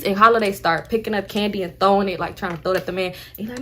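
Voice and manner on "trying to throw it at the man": normal talking voice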